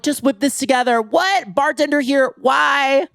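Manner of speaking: high, breezy feigned surprise; mock innocent. Mock-innocent reply to guests.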